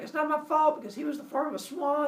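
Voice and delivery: high-pitched whiny excuse; mock defensive protest. Leto answering jealous Hera, defensive. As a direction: plead innocence in a high put-on voice. Leto's acted defence in quarrel.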